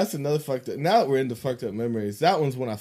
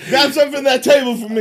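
high, fast barked order; demanding director calling to assistant; bossy boss; 12 semitones higher, 9 dB louder, faster than usual. Acted. Bossing imaginary crew member Jimmy.

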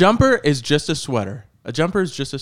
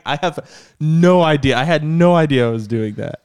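retold protest; 4 semitones higher, 5 dB louder than usual. Retells protesting innocence to his wife.

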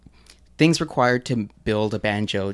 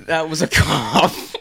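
high-pitched helpless laughter; can't stop laughing; laughing fit; 11 semitones higher, 9 dB louder, slower than usual. Real, not acted. Laughing fit, claims cough.

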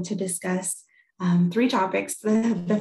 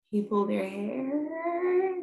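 slow, rising incredulous question; retold shocked mom, pitched high; held-back disbelief; 6 semitones higher, much slower than usual. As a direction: stretch it upward, trying to hide the upset. Mom hiding upset while questioning daughter.

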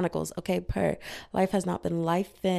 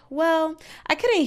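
sweet polite voice; 10 semitones higher, 6 dB louder, slower than usual. Retold or imitated. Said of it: "I was like".

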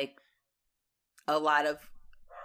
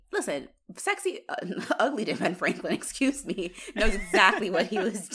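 usual speaking voice

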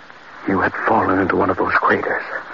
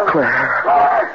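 horrified cry; 5 semitones higher, much slower than usual. Acted. Husband reliving the worm crushing his wife, crying out. Climax of horror recollection.

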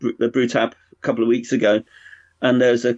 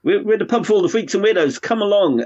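mock pub slogan; 9 semitones higher than usual. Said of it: "strive to say".

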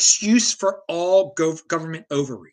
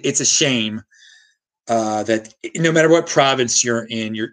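ordinary talk

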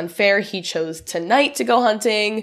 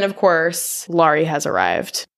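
usual speaking voice

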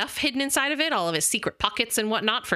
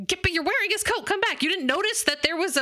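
much higher hurried urging; urgent call; 9 semitones higher than usual. Retold or imitated. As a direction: call him back urgently. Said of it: "She was like".

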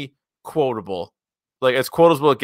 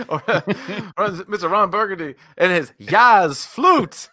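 laughing; 6 semitones higher than usual. Real, not acted. Laughing while quoting.

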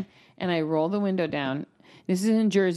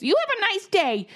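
high, sweet mock-polite; sugary sign-off; 12 semitones higher than usual. Brushing off a stranger with a high, sugary farewell. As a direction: chirp it like fake customer service. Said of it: "usually what I would say".

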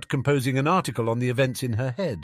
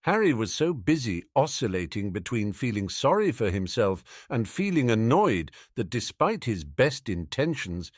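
plain reading voice

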